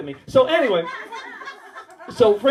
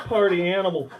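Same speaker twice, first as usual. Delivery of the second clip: ordinary delivery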